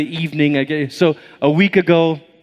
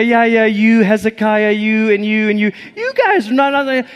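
jab at each person in turn; mock finger-pointing; 7 semitones higher than usual. Prophet singling out individuals, mock finger-pointing. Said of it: "They don't just say".

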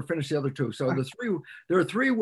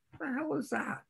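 incredulous; 11 semitones higher, 8 dB quieter than usual. Retold or imitated. Voicing a skeptical questioner.

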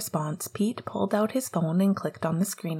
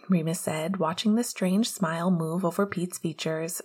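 plain reading voice

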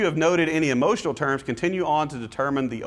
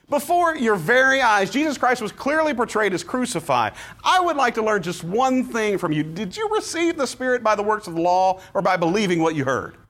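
Paul rebuking the Galatians, frustrated; exasperated scolding; 7 semitones higher than usual. Shown as a demonstration. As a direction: scold them like an exasperated teacher. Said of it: "you catch the tone".

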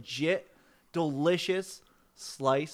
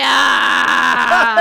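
loud, high burst of laughter; shrieking laugh; 8 semitones higher, 17 dB louder than usual. Real, not acted. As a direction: crack up hard and high. Bursting laugh after the shout.

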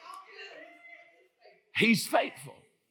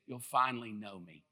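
soft, tender God; 7 semitones lower, 7 dB quieter than usual. Retold or imitated. Preacher voicing God's true words to the congregation, soft and tender. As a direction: lower and much softer, intimate. He says this.

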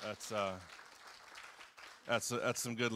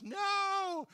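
sudden, much higher, louder "No!"; shocked outcry; 6 dB louder than usual. Retold or imitated. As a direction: cry out in alarm. Peter objects to Jesus dying.

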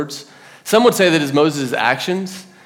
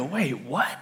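puzzled disbelief; 11 dB quieter than usual. Acted. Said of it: "we kinda go".

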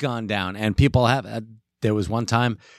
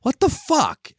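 mock outrage; 14 semitones higher, slower than usual.

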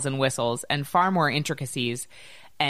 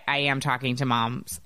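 ordinary talk, nothing marked

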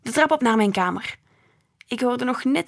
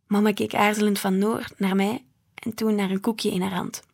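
ordinary delivery

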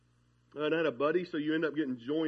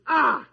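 exclamation; 10 semitones higher, 11 dB louder than usual. Real, not acted. Preacher exclaims mid-story.

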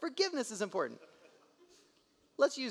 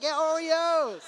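high, slow laughing cheer; drawn-out gleeful whoop; excited cheer; 10 semitones higher, 8 dB louder, much slower than usual. Retold or imitated. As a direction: celebrate the Oreos with her. Dad cheering with daughter.

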